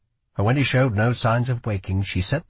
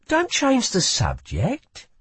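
put-on higher, sharp girl voice; young woman voice; 12 semitones higher, slower than usual. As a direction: voice a wary young thief. Lila rebuffs Kell.

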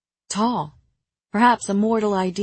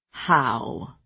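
low-voiced lord's question; deep, terse query; echoing lord; 5 semitones lower than usual. A narrator's reading. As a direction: echo it deep in the older man's voice. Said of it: "Nolan echoed".

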